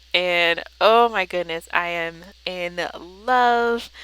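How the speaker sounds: loud, slow, thrilled gasp of joy; delighted gush. Real, not acted. Vlogger gushing to her viewers over the finished page, delighted.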